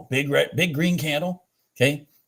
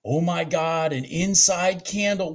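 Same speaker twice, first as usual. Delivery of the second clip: ordinary talk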